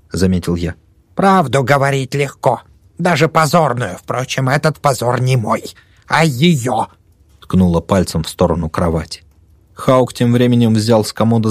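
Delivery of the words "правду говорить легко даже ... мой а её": put-on old woman voice. Old woman accuses patient.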